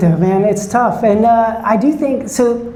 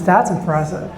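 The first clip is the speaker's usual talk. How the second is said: usual speaking voice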